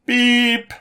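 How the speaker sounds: high flat beep; electronic beep. Acted. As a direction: chirp a beep like a machine. Comms beep.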